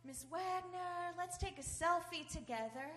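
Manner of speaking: high, drawn-out schoolgirl gushing; popular girl. Retold or imitated. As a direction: imitate a student cooing at her teacher. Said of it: "one of the popular girls said".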